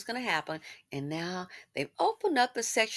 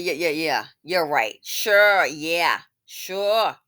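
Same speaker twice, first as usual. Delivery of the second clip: sarcastic agreement; 4 semitones lower, 6 dB louder, much slower than usual. Acted. Sarcastic agreement with imagined objector.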